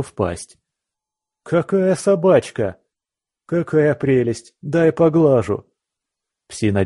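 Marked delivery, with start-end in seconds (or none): 1.4-5.7 gushing thug voice. Acted. Thugs coo over hellhound.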